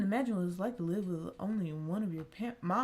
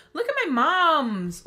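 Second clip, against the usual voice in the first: excited; 6 semitones higher, 10 dB louder, slower than usual. Real, not acted. Excited reaction.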